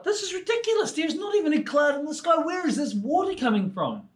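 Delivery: high, squeaky little bird voice, baffled and put out; a tiny swallow fussing about rain from a clear sky; puzzled swallow. Acted. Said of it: "He's like".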